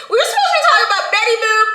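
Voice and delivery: amused laugh at going off topic. Real, not acted. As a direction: giggle it out quickly. Laughing at derailed conversation.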